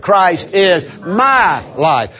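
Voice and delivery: "my" drawn out and punched; slow, with heavy weight on "my"; stress on my. Shown as a demonstration. Preacher emphasizing the third word, stress on "my". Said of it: "The third word".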